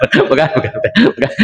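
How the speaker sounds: repeating it through a real chuckle; laughing off his own joke. Real, not acted. Laughs at his One Piece joke. (sambil tertawa)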